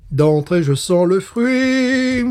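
drawn out high on "je sens le fruit", slow and lifted; slow savoured. Real, not acted. Beer taster savouring the first whiff, drawn out high. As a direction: savour each word like a taster.

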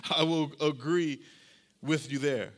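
ordinary delivery

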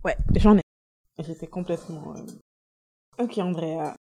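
quiet, tearful, choked up; snotty crying. Real, not acted. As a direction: speak softly through tears. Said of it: "Ma pauvre chérie".

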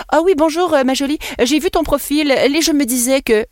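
mock-polite message in a French accent. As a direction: greet in an imitated Parisian-style French accent. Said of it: "je prends mon accent français".

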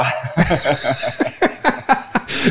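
real drawn-out laugh; laughter. Real, not acted. Cracking up over a rude language slip, long laugh. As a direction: burst out laughing at your own joke.

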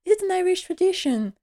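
high-pitched, casual imitation of a lady. Violet remarking on Tom's clothes at dinner, casual high voice. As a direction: toss it off in a high, casual put-on voice. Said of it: "she's very casual".

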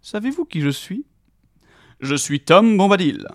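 loud proud self-introduction; booming announcement. A narrator's reading. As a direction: boom out your own name. Tom announcing himself grandly.

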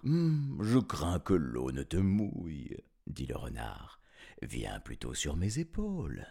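low, soft crafty fox voice; softly scheming fox; sly fox. Acted.

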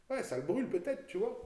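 high, loud sarcastic jab. Real, not acted. Mocking the burn claim.

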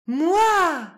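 put-on high, loud eager cry of a child; eager shout. A narrator's reading. Harry answering the riddle, eager and loud. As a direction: shoot the answer out, hand up.

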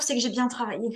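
ordinary talk

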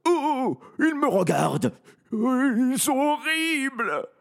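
high, panicked, near tears; terrified. Acted. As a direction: shriek it in terror. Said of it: "fait un bond de terreur".

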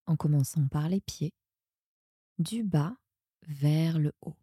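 usual speaking voice

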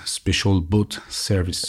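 slow, careful English accent. Shown as a demonstration. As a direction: say it like a real Brit, slowly. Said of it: "avec l'accent anglais qui va bien". (accent anglais appuyé)

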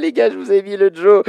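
talking through high, loud laughter; laughing. Real, not acted. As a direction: squeeze the words out between laughs. Host laughing at game seven answer.

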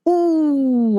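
a thrilled ooh, high and loud; ooh, hooked by the mystery; excited ooh. Real, not acted. Reacting to an unsolved mystery.

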